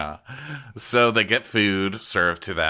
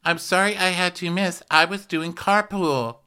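mock apology; 7 semitones higher than usual. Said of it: "She goes".

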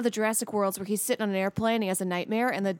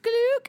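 door sound effect. Pretend. Imaginary improv door opening.

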